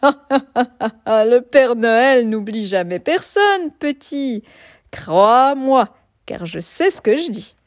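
slow, loud knowing wolf; old wolf voice. A narrator's reading. Wolf reassures cub.

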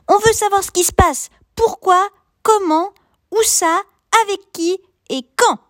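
high, fast pressing questions; eager child voice. A narrator's reading. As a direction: rattle off questions like a curious kid.

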